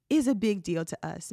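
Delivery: ordinary delivery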